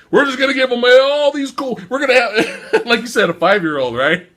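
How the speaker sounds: high, giddy overexcited pitch; mocking excited producer. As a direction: sell the dumb ideas like a thrilled kid. Mocking the producers' big ideas.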